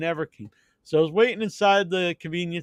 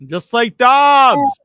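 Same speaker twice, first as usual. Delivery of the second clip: teasing quip; 9 semitones higher, 10 dB louder, much slower than usual. Real, not acted. Teasing quip, loud high.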